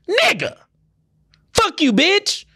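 high screamed curse; outraged yell. Acted. Driver ticketed by a meter maid, high furious yell.

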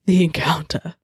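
breathy dramatic whisper; romance novel voice. Shown as a demonstration. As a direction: hush it like a romance novel narrator. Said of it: "that was my, um, handwritten romance novel voice".

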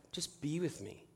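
very soft, gentle imitated request; soft plea. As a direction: quietly ask for company, as a wife. Wives' quiet plea to husbands.

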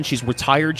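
normal talking voice

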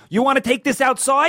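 high, taunting retold challenge; mock challenge. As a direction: squeak it like a picking-a-fight punk. Little man challenging bigger man.

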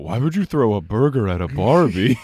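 imitated straight deadpan; Kawhi. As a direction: ask it like a literal, unamused man. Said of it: "Kawhi just straight deadpan".

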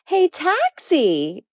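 high, slow call of Hey taxi; hailing a taxi. Shown as a demonstration. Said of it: "my brother gets a kick out of this one".

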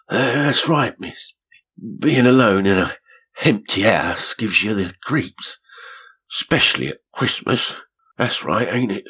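a startled little man, caught out; rough caretaker. A narrator's reading. Caretaker figure voiced.

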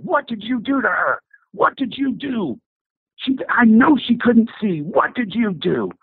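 high, slow, bewildered demand; a big guy's slow, high disbelief; stunned demanding brute. Retold or imitated. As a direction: press him hard for an answer, stunned. Son-in-law pins him, demanding.

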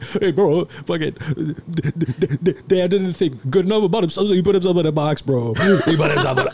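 high, loud, half-laughing blubber; ugly crying. Retold or imitated. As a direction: choke it out high and loud through tears. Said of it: "I'm, like, ugly crying".

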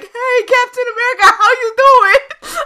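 high, loud holler, last words stretched; mock passing hail. Uber rider greeting a hero mid-battle, exaggerated holler. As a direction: call out cheerily, breaking into laughter. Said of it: "get an Uber to be like".

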